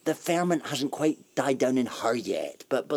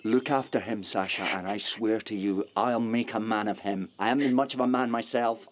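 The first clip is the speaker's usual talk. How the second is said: ordinary talk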